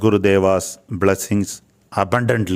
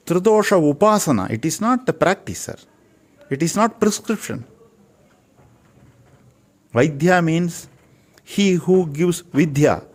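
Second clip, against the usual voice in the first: higher, intense lecturing voice; passionate preaching; 6 semitones higher than usual. Real, not acted. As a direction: rise up and preach it with fervour. Passionate lecture on Ayurveda's meaning.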